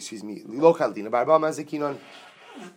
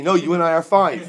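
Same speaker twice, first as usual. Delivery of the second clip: louder, higher, lightly hammed-up spirit; a touch of a taunting spirit; mock voice of the shadim; 5 semitones higher, 12 dB louder than usual. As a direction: play the demon answering back, half laughing. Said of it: "the voice of the Shadim".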